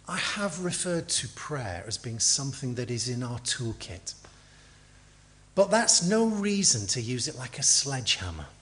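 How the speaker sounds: normal talking voice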